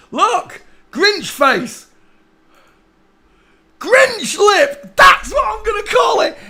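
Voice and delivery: high, loud delighted yell; real gleeful shouting; excited shout. Real, not acted. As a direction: shout out the discovery.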